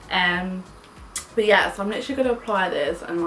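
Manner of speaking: ordinary talk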